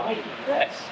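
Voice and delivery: ordinary talk, nothing marked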